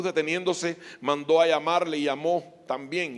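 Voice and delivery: ordinary talk, nothing marked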